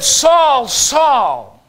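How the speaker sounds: high, loud call, dropping on the repeat. Acted. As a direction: call the name out like a summons from heaven. Voice from heaven calling Saul.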